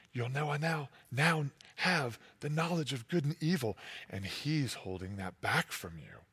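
low, soft, sly hush; crafty snake. Acted. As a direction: draw them in like a scheming serpent. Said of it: "more crafty than all the other animals".

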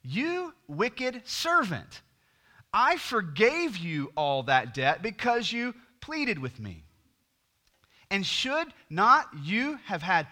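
high, slow, drawn-out scolding; wicked master's rebuke. Acted. Master condemning his servant, high and slow rebuke. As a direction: stretch out every word as the furious master. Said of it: "his master summoned him and said to him".

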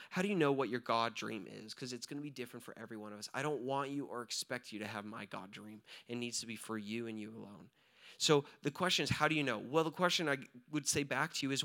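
ordinary delivery

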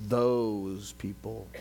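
THOSE drawn out slowly before "people"; disdainful stress. Acted. Churchgoers viewing outsiders as not their own, slow disdainful stress on THOSE. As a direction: linger on THOSE to set them apart. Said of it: "that's how they s-, viewed it".

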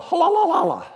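high trilling halal cry; ululating halal. Shown as a demonstration. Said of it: "an exaggeration of the Hebrew term halal".

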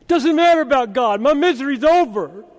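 high, loud, heedless celebrating; hammed-up relieved crowing; mock jubilation. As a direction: brush it off gleefully, imitating a self-absorbed convert. Acts out people stuck at stream.

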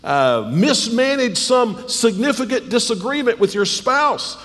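fiery preacher, high and loud; preaching. Acted. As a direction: preach it hard from the pulpit. Preaching at full force.

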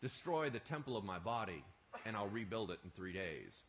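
calm explanation. Shown as a demonstration. What did he mean?.

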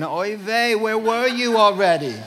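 put-on Yiddish accent.

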